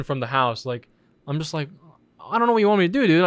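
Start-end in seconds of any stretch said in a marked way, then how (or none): 2.2-3.2 higher, louder retold protest; exasperated retort. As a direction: act out being fed up with the cop. Retold exasperated reply to cop.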